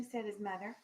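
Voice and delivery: ordinary talk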